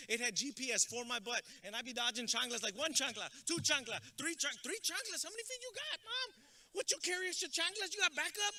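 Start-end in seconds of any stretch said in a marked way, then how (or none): 2.8-8.5 counting that climbs into high-pitched disbelief; mock rising alarm. Kid dodging mom's thrown chanclas, mock alarm climbing high. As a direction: start counting, then squeal higher in comic incredulity.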